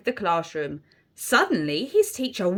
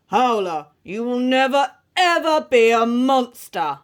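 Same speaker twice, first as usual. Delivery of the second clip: loud, heavy ogre headmaster; booming ogre; 6 dB louder than usual. A narrator's reading. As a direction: boom the verdict at the pupil. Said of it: "boomed Mister Ogre".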